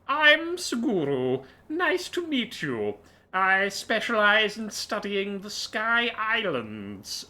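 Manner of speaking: high, slow, earnest researcher voice; scholar character. Acted. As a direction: introduce yourself proudly in a high voice.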